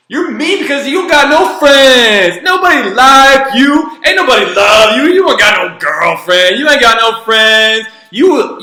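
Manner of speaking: loud, high-pitched imitation of a ranting kid; going off. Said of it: "she just went off like that".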